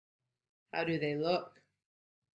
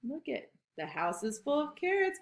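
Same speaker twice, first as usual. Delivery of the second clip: ordinary delivery, nothing marked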